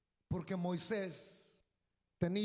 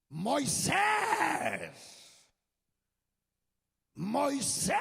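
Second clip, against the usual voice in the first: loud booming call across distance; God calling out; 4 semitones higher, 6 dB louder, much slower than usual. Acted. God calling Moses from the bush, loud booming call. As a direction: call his name like a voice from the burning bush.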